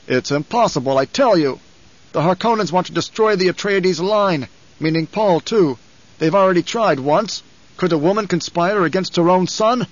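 heated nobleman in disbelief; indignant duke. A narrator's reading. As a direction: argue it down forcefully.

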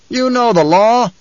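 high, loud, slow reminder; stern objector. Acted. Man in the rocks presses his leader, stern. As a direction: call down the law insistently.

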